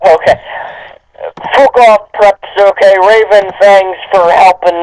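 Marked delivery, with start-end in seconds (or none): none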